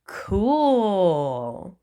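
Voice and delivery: long drawn-out wistful "Cool"; awed. A narrator's reading. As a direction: stretch "Cool" out in helpless awe. Wistful awe at cool beans.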